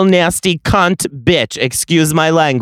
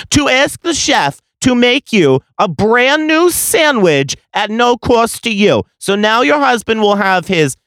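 heated rant; 7 semitones higher than usual. Retold or imitated.

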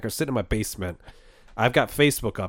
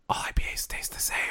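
whispering; 9 dB quieter than usual. Real, not acted. Hushed aside about IPAs.

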